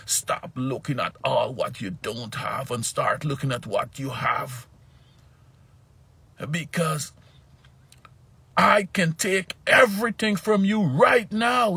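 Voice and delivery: high, loud, stern scolding voice; angry God scolding. Retold or imitated. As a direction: scold like a furious father to his child. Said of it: "and he was mad".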